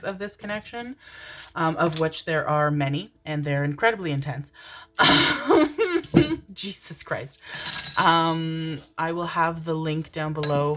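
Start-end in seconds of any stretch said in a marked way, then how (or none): 4.6-6.5 a sudden high, loud laugh; loud high burst of laughter. Real, not acted. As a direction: crack up out loud. Sudden laugh at own words.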